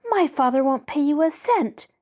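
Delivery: defiant outburst at her captors; furious. Acted. Nancy furiously defying her kidnappers. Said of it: "Nancy broke in furiously".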